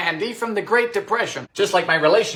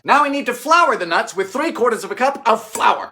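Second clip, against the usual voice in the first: ordinary delivery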